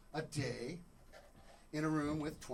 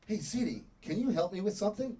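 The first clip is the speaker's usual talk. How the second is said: louder, lifted question to a voice assistant; upbeat request to Siri; 4 semitones higher, 5 dB louder than usual. Acted. Lonely husband asks Siri for help, upbeat request. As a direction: ask the phone for a favour. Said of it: "So I turn on Siri and I go".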